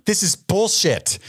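high, slow muttered complaint; drawn-out high mock grumble; imitating Julianne Moore. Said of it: "Julianne Moore is going".

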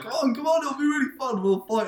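very high, coaxing mock voice of the friend; mock pleading friend. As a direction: wheedle him into a fight in a squeaky, high voice.

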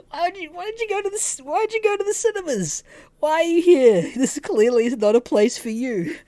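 high-pitched incredulous rant; exasperated. Retold or imitated. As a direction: demand answers in a high, exasperated voice. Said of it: "I'm fucking doing my head in".

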